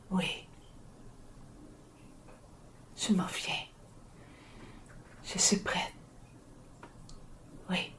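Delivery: soft breathless whisper, fading; barely whisper, surrendering to the end; faint whisper. Acted. Dying woman answering those who come for her, faint whisper.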